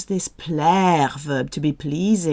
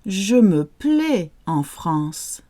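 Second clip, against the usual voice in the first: slow, careful French teacher model; slow model phrase; slower than usual. Shown as a demonstration. Teacher slowly modelling a phrase for learners. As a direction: say it slowly so learners can repeat. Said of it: "say it nice and slowly first of all".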